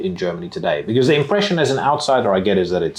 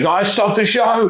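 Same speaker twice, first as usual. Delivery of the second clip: much higher, slow, mock-alarmed voice; mock panicked call; 13 semitones higher, slower than usual. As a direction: play a frantic guy halting the gig, hammed up. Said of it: "He's like".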